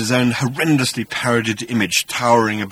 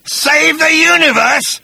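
slow, high, loud scornful question; contemptuous; 6 dB louder, slower than usual. Acted. Agrajag mocking Arthur's mission, contemptuous shout. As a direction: spit it back in drawn-out disbelief. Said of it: "spat Agrajag with contempt".

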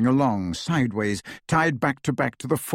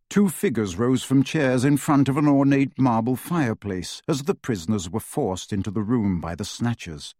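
usual speaking voice

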